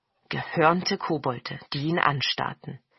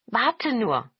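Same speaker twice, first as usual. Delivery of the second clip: bright-pitched, eager call; lively goblin cry; 6 semitones higher, 4 dB louder than usual. A narrator's reading. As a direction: call it out to hold him back. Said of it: "riefen die Togepi".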